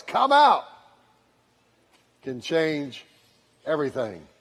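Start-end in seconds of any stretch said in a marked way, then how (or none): 0.0-0.6 high calling-out, mildly projected; loud-voice call. Retold or imitated. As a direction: imitate a summoning call on a raised pitch. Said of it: "one loud voice".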